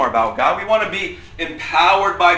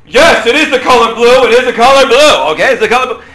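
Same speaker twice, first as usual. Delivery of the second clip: booming, high-pitched shouted answer; very loud voice; 9 semitones higher, 15 dB louder, faster than usual. Retold or imitated. Dad answering his kid while making breakfast, very loud voice. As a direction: bellow it insistently, far louder than needed. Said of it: "answering in a very loud voice".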